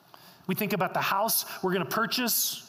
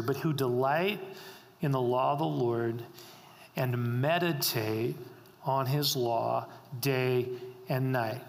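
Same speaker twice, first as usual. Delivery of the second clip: a low, unhurried reading of a psalm; scripture reading; 5 semitones lower than usual. Acted. Reads scripture verse slowly.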